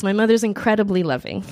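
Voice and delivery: normal talking voice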